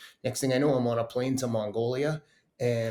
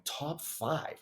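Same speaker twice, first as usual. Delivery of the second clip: higher, softer questioning echo; retold incredulous echo; 5 semitones higher, 6 dB quieter than usual. Echoing a friend's modest target for the race, incredulous. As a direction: throw it back as if insulted. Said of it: "I remember saying to him, like".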